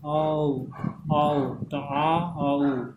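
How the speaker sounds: आओ held long, then parted into आ and उ; isolated diphthong. Shown as a demonstration. Teacher isolating the vowels of नाव, sounds held long. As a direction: sound out आ and उ slowly. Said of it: "दो sound आ रहा है".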